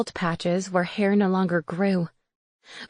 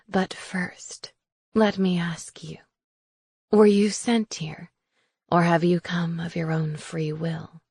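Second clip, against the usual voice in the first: whispered, slow crone question; whispering witch; 2 semitones lower than usual. Acted. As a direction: whisper like a sly old hag.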